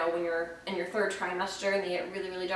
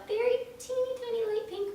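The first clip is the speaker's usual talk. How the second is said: high cutesy voice; 14 semitones higher than usual. Real, not acted. Describes tiny pink spotting.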